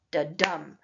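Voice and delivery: "da-dum" with a soft "da" and a hard "dum"; iambic rhythm. Shown as a demonstration. Teacher demonstrating iambic rhythm like a heartbeat, unstressed then stressed. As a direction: heartbeat "da-dum", weight landing on "dum". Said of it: "a soft beat followed by a hard".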